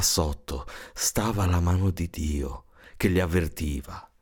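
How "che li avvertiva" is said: usual speaking voice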